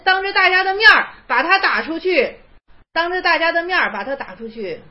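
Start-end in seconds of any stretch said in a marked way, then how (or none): 0.0-2.3 raised, forceful telling; 大声强调; emphatic loud. Shown as a demonstration. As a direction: hammer the point home, 当着大家的面儿 ringing out. Repeats the instruction, loudly.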